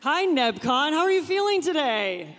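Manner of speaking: loud, high, excited hello to the crowd; excited crowd greeting. Real, not acted. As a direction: greet the whole hall with big energy.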